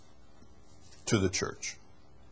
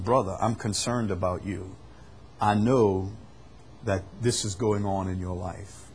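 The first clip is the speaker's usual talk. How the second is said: softer, lower caring plea to a brother; loving concern; 4 semitones lower, 6 dB quieter than usual. Acted. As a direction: speak gently, out of love. Voices loving confrontation of a brother.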